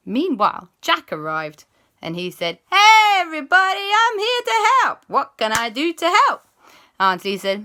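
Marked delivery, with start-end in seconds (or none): 2.7-6.5 put-on high, loud, keen boy's voice; eager greeting. Jack arriving to help everyone, high and loud. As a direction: bounce in shouting hello.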